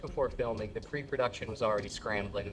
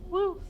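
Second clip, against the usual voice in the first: light woo; 4 dB louder than usual. Retold or imitated. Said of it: "lets out a light".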